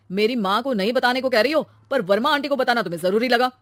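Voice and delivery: loud, accusing rebuke; indignant husband. Acted. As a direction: accuse your wife angrily. Husband quarrels with wife. (गुस्से में ताना देता पति)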